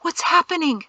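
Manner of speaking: high, anxious question; alarmed. A narrator's reading. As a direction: ask in rising alarm. Frantic reply to companion's distress.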